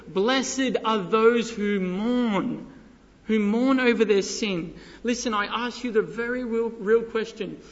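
impassioned preacher, stretching key words; fervent preaching. Acted. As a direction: press each phrase on the congregation. Preaches with raised fervor.

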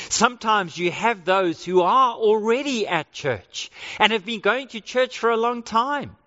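normal talking voice

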